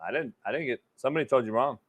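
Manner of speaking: a host ducking a money request, barely audible; sheepish mumble. Real, not acted. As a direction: mumble it, dodging the question.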